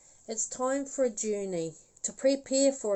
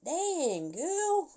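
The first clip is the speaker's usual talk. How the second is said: amazed; 10 semitones higher than usual. Retold or imitated. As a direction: pitch leaping way up on girl. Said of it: "it was like".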